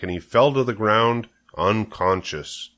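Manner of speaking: plain reading voice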